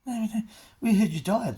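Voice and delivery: high-pitched retold gossip; imitated concerned people. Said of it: "People going".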